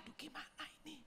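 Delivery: preacher showing how she sounded with no voice; voiceless rasp. Retold or imitated. Croaked whisper of a woman who lost her voice. Voice gone, story of hoarseness.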